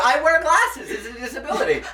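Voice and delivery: laughing. Real, not acted. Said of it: "joking claim".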